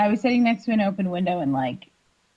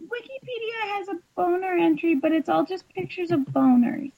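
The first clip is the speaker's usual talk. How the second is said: laughing; 7 semitones higher than usual. Real, not acted. Giggling at boner pictures.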